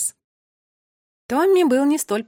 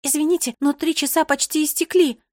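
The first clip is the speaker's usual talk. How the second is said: higher, louder, worried voice; politely apologetic but anxious; anxious explanation; 6 semitones higher, 4 dB louder than usual. A narrator's reading. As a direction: explain with rising alarm. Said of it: "с тревогой пояснила она".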